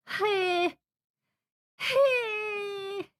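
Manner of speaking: high gasping pre-sneeze catches; breath hitching before a sneeze; about to sneeze. Real, not acted. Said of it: "You prepping to sneeze?".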